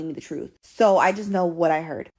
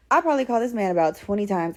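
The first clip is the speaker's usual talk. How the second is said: ordinary talk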